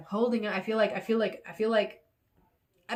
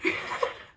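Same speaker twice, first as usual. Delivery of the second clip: short breath of laughter; self-amused laugh. Real, not acted. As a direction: chuckle at your own tantrum. Laughs at her own fit.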